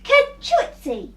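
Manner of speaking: high, loud mock sneeze. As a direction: sneeze it out big. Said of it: "Peter sneezed".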